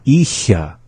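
ISHA as one short joined word; up to speed. Shown as a demonstration. Teacher saying the word for repeating, up to speed. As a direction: say ISHA without a break. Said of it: "up to speed".

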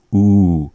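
"u" said as a loud "oo"; a strong, isolated "oo" for "u"; emphatic "u" sound. Shown as a demonstration. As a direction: sound out "u" on its own, loud and clear. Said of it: "on those kinds of words".

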